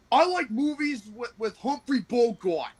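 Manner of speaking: high, put-on voice of a friend; imitating buddy. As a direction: lightly mimic a Buffalo buddy. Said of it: "he goes like".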